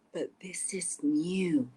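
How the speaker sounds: slow, whispery sick grandmother; ill gran. A narrator's reading. Ill gran warning Little Red, slow whispery voice. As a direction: whisper it weakly like an ailing gran. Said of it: "her ill gran said".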